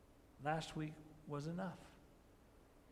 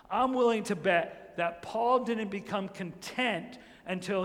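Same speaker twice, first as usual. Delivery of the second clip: loud, driving sermon delivery, a touch higher; full-force preaching; 3 semitones higher, 9 dB louder than usual. Acted. Preacher proclaiming to the congregation, loud full-force preaching. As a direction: preach it to the back row.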